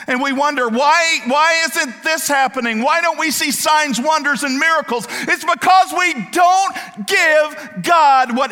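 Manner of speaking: loud, high-pitched preaching that keeps building; preaching at full force. Acted.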